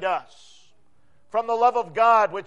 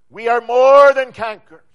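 loud, emphatic, weight on "more"; 9 dB louder than usual. Shown as a demonstration. Preacher showing congregation how the words should boom: loud emphatic. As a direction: declare it so it booms. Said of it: "Those are very emphatic words".

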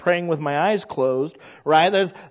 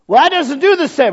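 doubting objector; 9 semitones higher, 7 dB louder than usual. Acted. Imagined objector to quiet prayer.

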